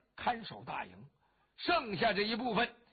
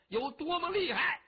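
boastful battle challenge; 7 semitones higher, 5 dB louder than usual. Acted. Boasting challenge to the enemy general.

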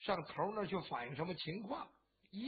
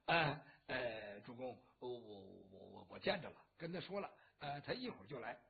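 much lower, quieter, stumbling over the words; nervous stammer; 9 semitones lower, 6 dB quieter than usual. Acted. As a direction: caught out, fumbling for a cover story. 郝德武 stammers, hiding the truth. (心虚结巴，压低嗓子支支吾吾)

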